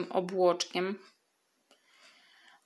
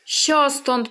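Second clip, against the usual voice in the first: shooing shout; 5 semitones higher, 5 dB louder than usual. Acted.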